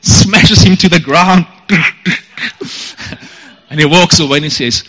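ordinary talk

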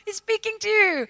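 high, loud rallying cheer; an inner cheerleader urging you to trust. Retold or imitated. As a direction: chant it like a pep squad on the sidelines. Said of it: "a cheerleading squad inside of me saying".

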